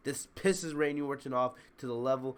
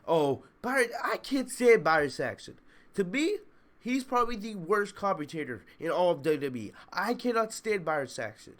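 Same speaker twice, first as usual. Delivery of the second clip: normal talking voice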